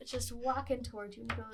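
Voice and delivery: ordinary talk, nothing marked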